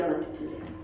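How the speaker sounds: soft whispered confession; hushed whisper; whispered secret. Retold or imitated. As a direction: breathe it out like a secret.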